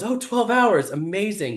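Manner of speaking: high, pleased retold exclamation; delighted reaction. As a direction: voice a partner cheering good news. Voices partner's delighted reaction on phone.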